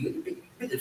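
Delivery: normal talking voice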